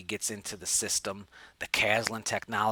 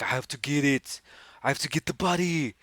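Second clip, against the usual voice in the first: mock-desperate, strained and higher; frantic shopper; 3 semitones higher, 4 dB louder than usual. Shopper in a toy rush after the buddy, mock frantic. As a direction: ham up a shopper's desperate need.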